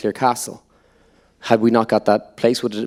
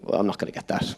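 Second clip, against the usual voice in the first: inner thought; 8 dB quieter than usual. Retold or imitated. Thinking to himself after audition.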